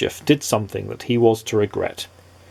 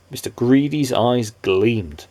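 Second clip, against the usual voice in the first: ordinary delivery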